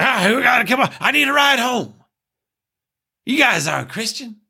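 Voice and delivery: a hint of a raspy old smoker begging for a ride; crusty old smoker. Mocking a sketch's crusty old woman in a put-on voice. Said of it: "doing a guttural voice, like an old smoker's voice".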